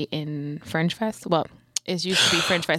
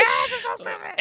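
cackling. Retold or imitated. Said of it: "I just cackled loudly".

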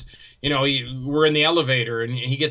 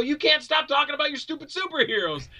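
1 exasperated retort; 12 semitones higher than usual. Retold or imitated. His angry retort.

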